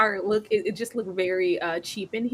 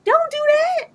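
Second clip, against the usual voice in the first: much higher, louder, disbelieving; incredulous; 7 dB louder than usual. Real, not acted. Podcaster reacting to a cringey line, squealing in disbelief. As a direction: shriek it in mock horror.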